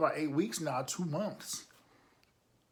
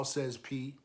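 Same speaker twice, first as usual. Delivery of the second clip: ordinary delivery, nothing marked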